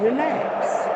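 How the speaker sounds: lifted, soothing relax with a rise; gentle relax. Shown as a demonstration. Showing viewers how to tell the body to relax. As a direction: coax them to relax. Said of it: "Just simply saying".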